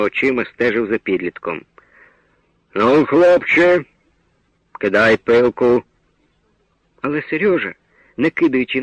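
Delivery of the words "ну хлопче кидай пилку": slow, menacing command from below; stern order. A narrator's reading. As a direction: lay down the law, drawn out and heavy. Forester orders the boy down. (суворий повільний наказ лісника)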